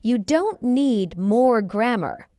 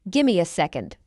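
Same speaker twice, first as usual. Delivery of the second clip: quicker, lower "Gimme a second"; fast English; 4 semitones lower, faster than usual. Shown as a demonstration. Teacher trains learners' ears, fast. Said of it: "fast English is not difficult".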